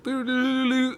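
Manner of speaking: high, playful siren noise; mock alert jingle. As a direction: sing out a silly high warning sound. Imitates a spoiler alert siren.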